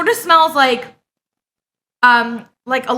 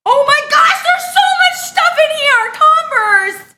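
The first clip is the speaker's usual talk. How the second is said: loud, very high excited cry; overwhelmed squeal; 10 semitones higher, 3 dB louder than usual. Real, not acted. Streamer to friend who sent many gifts, overwhelmed squeal.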